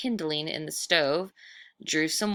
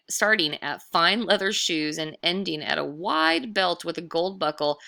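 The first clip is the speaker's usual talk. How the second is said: usual speaking voice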